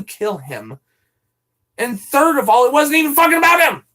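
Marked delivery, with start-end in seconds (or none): 1.8-3.8 loud, high denial, building faster; exasperated shout. Real, not acted. Streamer denies threatening Mister Zombie Bite, exasperated shout. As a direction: build into a real angry yell.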